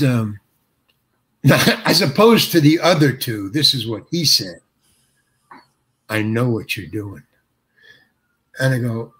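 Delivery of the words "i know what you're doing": low, dismissive retold jab; with attitude. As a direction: quote him coolly, pitched low, with attitude. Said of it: "with an attitude".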